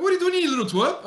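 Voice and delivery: put-on telling off the church kid, high and quick; mock outrage. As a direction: scold the kid in retold outrage.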